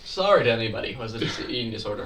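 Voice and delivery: fast, hurried apology; rushed disclaimer. Real, not acted. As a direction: rattle the disclaimer off quickly.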